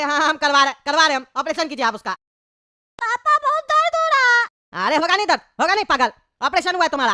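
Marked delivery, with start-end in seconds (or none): 3.0-4.9 put-on high-pitched whining child voice; squeaky kid complaining of a bellyache; high, plaintive cartoon child; little boy in pain. Child after operation, in pain.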